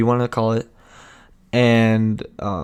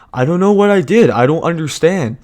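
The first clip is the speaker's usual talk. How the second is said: put-on higher, louder pleading kid voice; whiny innocent kid; 6 semitones higher, 5 dB louder than usual. Kid pleading ignorance to the teacher, higher whiny voice. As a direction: play the kid who swears he did nothing.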